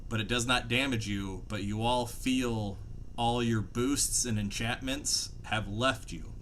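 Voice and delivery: ordinary delivery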